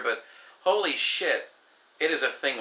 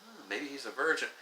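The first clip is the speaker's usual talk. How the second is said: much softer, sly aside; gossipy murmur; 9 dB quieter, faster than usual. Retold or imitated. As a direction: murmur it like the crowd piling on. Crowd gossip echoing accusation.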